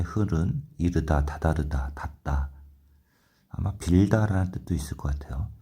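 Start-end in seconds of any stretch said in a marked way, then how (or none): none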